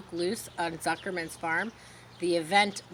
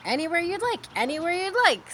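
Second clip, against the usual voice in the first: much higher, chattering goose; a breezy goose urging him on; high, repeating goose voice; 10 semitones higher, 5 dB louder than usual. Acted.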